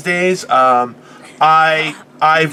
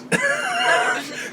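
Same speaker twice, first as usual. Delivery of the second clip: high laugh; 9 dB quieter than usual. Real, not acted. Laughing at own story.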